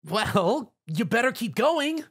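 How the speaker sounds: high, mild call; call while flying off. A narrator's reading. Rainbow calls back as she flies off, high. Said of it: "Rainbow yelled".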